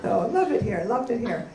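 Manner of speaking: ordinary talk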